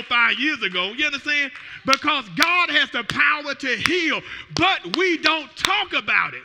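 loud, high shouted preaching; fiery preaching. Real, not acted. Pastor testifying healing to church, loud shouted preaching. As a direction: shout the praise to the rafters.

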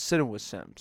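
"Sitting" with a swallowed t; relaxed "Sitting", middle and ending softened; own accent. Real, not acted. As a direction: say "Sitting" casually as sit-in, no hard g. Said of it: "That's my accent".

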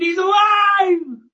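high, loud, slow joyous shout; room screaming. Retold or imitated. The coma patient's room shouting he's alive, elated shout. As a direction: cry it out like the whole room. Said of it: "The whole room screamed".

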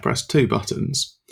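ordinary talk

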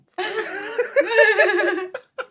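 loud, high peal of laughter. Real, not acted. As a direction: laugh until you can't talk. Laughing retelling the concert.

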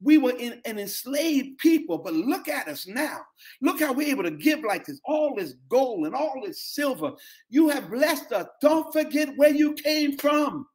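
testifying prayer, voice lifted up and held; fervent preached prayer. Acted. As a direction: pray it out at full force like a pulpit climax. Preaching prayer at full force.

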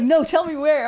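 demanding to be shown; mock insistent. Imitating husband insisting.

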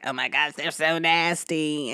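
put-on a child tasting something awful; mock-disgusted daughter; disgusted kid. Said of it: "she was like".

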